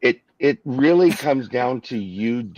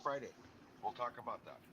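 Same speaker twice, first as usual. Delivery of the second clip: much softer, far from the mic; faint off-mic voice; 3 semitones higher, 25 dB quieter, faster than usual. Real, not acted. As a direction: speak from across the room, barely reaching the mic. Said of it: "I can't hardly hear you".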